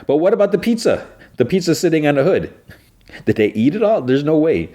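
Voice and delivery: high, incredulous pizza outrage; a real gasp of surprise at the missing pizza; amazed disbelief. Real, not acted. Reacting to the vanished pizza.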